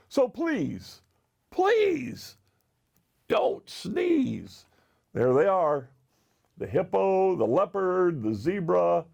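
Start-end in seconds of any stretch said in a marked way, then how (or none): 0.1-4.7 high, drawn-out begging; pleading. A narrator's reading. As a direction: plead as a worried hippo. Hippo begging the elephant.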